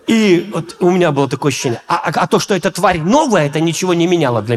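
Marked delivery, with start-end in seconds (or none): none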